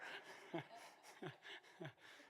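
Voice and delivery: quiet airy laugh. Real, not acted. Preacher chuckling after teasing the congregation. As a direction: chuckle under breath.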